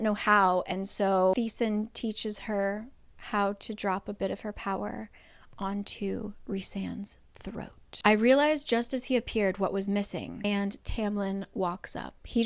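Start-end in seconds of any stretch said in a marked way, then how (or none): 4.3-8.0 hushed, slow whisper. A narrator's reading. Narrator hushing the moment of the revival, whispered. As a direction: breathe it out slowly.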